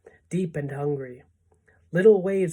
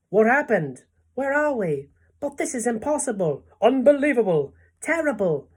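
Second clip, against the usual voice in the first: high-pitched, shocked exclamations; alarmed disbelief; 7 semitones higher, 5 dB louder than usual. A narrator's reading. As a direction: blurt out one shocked cry after another. Startled crowd finds itself at sea.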